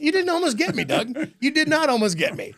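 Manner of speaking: a mock protest you can't keep a straight face through; laughing protest. Retelling mock protest to prankster boss.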